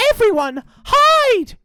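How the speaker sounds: put-on high, loud child's shout; urgent cry. A narrator's reading. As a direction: yell the alarm, leaping up in pitch. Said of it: "cried Jonathan".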